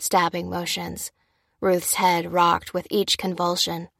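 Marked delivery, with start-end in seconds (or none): none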